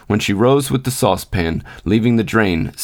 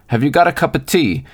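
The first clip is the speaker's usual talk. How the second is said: a light, lifted cheery greeting; hearty old engine driver, lightly voiced; 5 semitones higher than usual. A narrator's reading. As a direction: call up to her heartily, a touch higher. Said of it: "in a cheery, hearty fashion".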